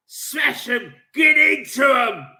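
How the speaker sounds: loud, high battle cry, building; fierce urging shout. Real, not acted. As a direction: bellow it to spur the team on. Working himself up, urging team.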